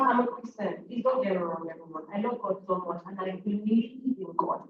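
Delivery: ordinary delivery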